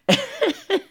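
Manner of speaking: short, high-pitched laugh; real giggle. Real, not acted. Amused by own accent routine, high giggle. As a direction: giggle at your own silliness.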